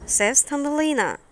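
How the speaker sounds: usual speaking voice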